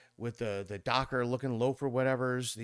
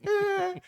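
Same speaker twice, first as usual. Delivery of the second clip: weak groan; 5 dB louder than usual. Retold or imitated. Curled up, hurt.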